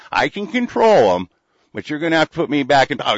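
shouted bragging threat with rising pitch; mock threat. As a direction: puff up and threaten them loudly. Acted threat in Jones's voice.

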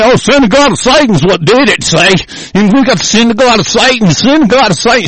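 high-pitched mocking quote; mocking imitation. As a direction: mimic their excuse with angry contempt. Mocks religious opponents' excuse.

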